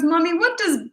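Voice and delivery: put-on young daughter piping up; little daughter's voice. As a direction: imitate a small girl asking from the back seat. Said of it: "And she goes".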